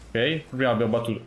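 ordinary talk, nothing marked

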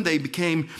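normal talking voice

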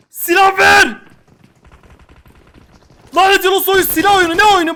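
loud, high, frantic demand; shout for a gun. Real, not acted. Gamer yelling at the game for a weapon, frantic shout. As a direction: yell it at the game.

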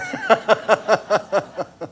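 hearty loud laughter; real laugh. Real, not acted. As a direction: laugh at his own joke. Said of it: "laughter at punchline".

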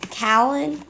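"Callan" with a short a as in cat; mispronounced name. Shown as a demonstration. Telling viewers how not to say the name, mispronounced "Callan". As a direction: say "Callan" with the first vowel of "cat". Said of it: "do not pronounce it".

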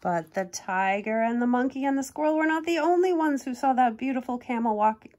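lively storybook reading voice; reading a picture book to kids; story narration. A narrator's reading. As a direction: read it like a bedtime picture book.

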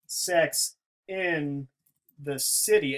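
word by word, with long gaps; slow, separated words. Shown as a demonstration. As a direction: draw it out and pause between the words. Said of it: "the N syllable is what lingers".

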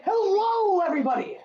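high, bright enthusiastic greeting; over-the-top excited intro; with enthusiasm. Shown as a demonstration. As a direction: sell the hello to the fans. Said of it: "You gotta say it with some enthusiasm".

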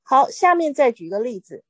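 ordinary talk

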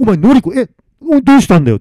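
loud, high, stammering disbelief; shocked. Acted. Masamitsu confronting his dead friend's voice, shocked. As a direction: blurt it out, voice leaping up.

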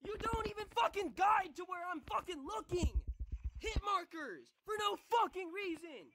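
high-pitched furious rant; raging yell. Real, not acted. Gamer cursing the game over hit markers, raging yell. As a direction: rant at full frustration, pitch way up.

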